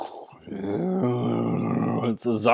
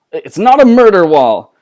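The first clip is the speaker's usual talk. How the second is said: louder, higher indignant protest; indignant outburst; 8 semitones higher, 15 dB louder than usual. Acted. Ballister correcting Nimona about the wall, indignant outburst. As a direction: correct her, exasperated and loud.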